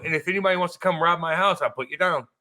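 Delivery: usual speaking voice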